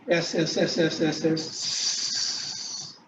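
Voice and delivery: S, S hissed long and unvoiced, fading out; drawn-out s like a snake. Shown as a demonstration. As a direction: hiss the S slowly like a snake. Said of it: "The sound is like S".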